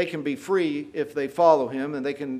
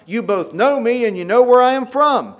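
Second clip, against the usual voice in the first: frustrated outcry; 4 semitones higher, 7 dB louder than usual. Retold or imitated. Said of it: "cries out in the temple".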